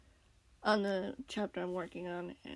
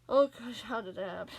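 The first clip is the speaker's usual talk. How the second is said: normal talking voice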